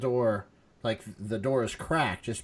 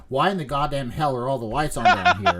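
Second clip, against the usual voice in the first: loud, annoyed father yelling; yelling dad; 2 semitones higher, 7 dB louder than usual. Retold or imitated. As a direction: bark it like an irritated dad. Dad yelling about lights.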